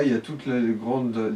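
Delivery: ordinary talk, nothing marked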